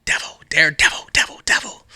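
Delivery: whispered Devils, Daredevils with a creepy hiss; spooky whisper. Pretend. Whispering the label like a spooky chant. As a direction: hiss it like a ghost story.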